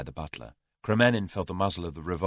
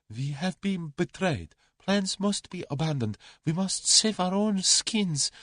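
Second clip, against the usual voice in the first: urgent plea; 10 semitones higher, slower than usual. A narrator's reading. Kremenin urging panic, betrayed.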